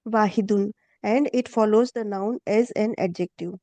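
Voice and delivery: slow, careful read of the textbook; a teacher reading the English lesson slowly to students; reading the textbook aloud. Shown as a demonstration. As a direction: read it out slowly for the class, word by word.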